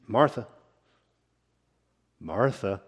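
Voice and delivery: calm her down by gently saying her name; quiet, soothing call to settle her; gentle. Retold or imitated. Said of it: "in his gentleness and in his grace".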